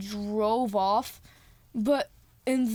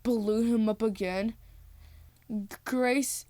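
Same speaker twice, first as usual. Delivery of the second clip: ordinary talk, nothing marked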